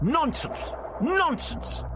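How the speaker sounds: high, indignant retort; snapping back. A narrator's reading. Doctor rebuffing the voice's accusation, snapping. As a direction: snap it out high and sharp. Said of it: "he snapped back".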